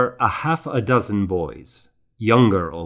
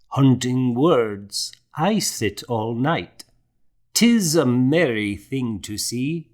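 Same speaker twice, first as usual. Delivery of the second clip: slow, higher, measured recitation; reciting a poem; 4 semitones higher than usual. A narrator's reading. Old scribe recites his cat poem for the young monk. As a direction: savour each rhymed line like verse. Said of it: "Luke recited".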